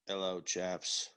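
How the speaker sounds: slow, low British greeting; low mock British. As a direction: drone it like a dull British bloke. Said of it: "He was more like".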